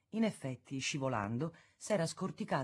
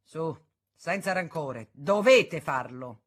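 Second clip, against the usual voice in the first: slow, commanding, pressing the last word louder; imperious; 7 dB louder, slower than usual. A narrator's reading. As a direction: order them to shake hands. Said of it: "con un gesto imperioso".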